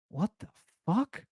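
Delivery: hushed, rising, incredulous question; retold dumbfounded reaction; stunned disbelief. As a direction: draw it out softly in shock. Retells stunned reaction to the claim.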